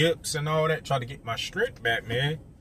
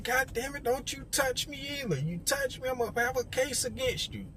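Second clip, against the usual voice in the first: higher, softer warning; mock the angry patient, pitched up; 9 semitones higher, 6 dB quieter than usual. Patient warning the guard not to touch him, higher. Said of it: "he was just like".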